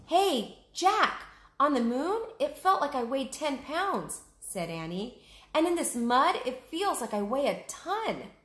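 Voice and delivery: put-on a girl joking despite trouble; voiced Annie. Annie jokes while stuck.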